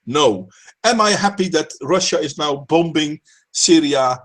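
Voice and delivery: usual speaking voice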